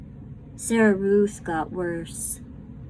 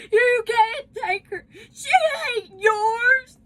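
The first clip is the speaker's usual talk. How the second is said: higher, tearful frantic yell; crying scream; 4 dB louder than usual. Acted. Bryce screams as the father carries her off, crying. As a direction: scream it through sobs. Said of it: "Bryce screamed".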